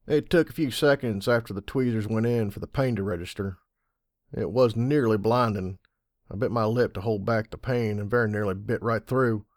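usual speaking voice

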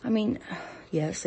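ordinary talk

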